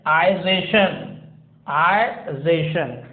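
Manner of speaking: ization with a long "eye" sound, slowly; British ization; British -isation. Shown as a demonstration. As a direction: say ization as eye-zay-shun. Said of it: "is pronounced as".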